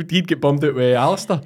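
normal talking voice

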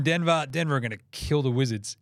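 normal talking voice

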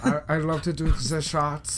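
badly faked Russian accent; vaguely Russian accent. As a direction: imitate a foreigner in shaky Russian-like English. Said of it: "Was that Russian?".

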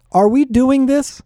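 loud high impatient yell. A narrator's reading. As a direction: shout it out to the group. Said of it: "Barrett yelled".

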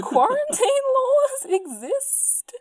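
high, loud, drawn-out question; mock incredulity. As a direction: stretch it out as if stating the obvious. Voicing ferry staff refusing animals.

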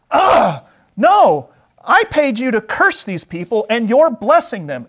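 high, loud, exasperated protest; hammed-up royal tantrum; outraged king. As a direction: explode at someone you paid who betrayed you. Voices furious King Balak.

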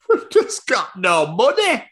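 high, silly management voice; hammed-up high voice of a broke cinema; mock cinema management. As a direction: draw out the stressed words in a high mock voice. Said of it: "Cinema had obviously gone".

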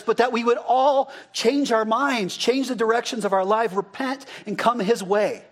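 ordinary delivery

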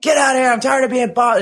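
high angry shout of an annoyed patient; retold angry yell. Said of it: "he's like".

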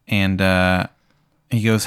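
ordinary talk

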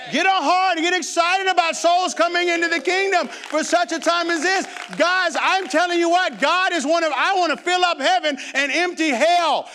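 rally the church to win souls; full-force preaching. Acted. Pastor urges his church to win souls, fervent preaching.

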